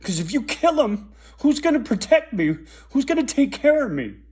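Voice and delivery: rising panic from a mother pleading with her son; desperate mother. Retold or imitated. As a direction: beg him not to, scared of losing her protector. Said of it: "Mom said".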